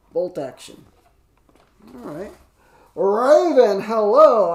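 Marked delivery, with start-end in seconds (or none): 2.9-4.5 loud greeting. Real, not acted. Greeting a stream viewer.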